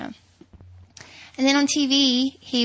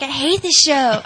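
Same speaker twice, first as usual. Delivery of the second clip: louder, higher imitation of a grumbler; mock complaint; 4 semitones higher, 9 dB louder than usual. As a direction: mimic his loud protest, teasing. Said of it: "You're like".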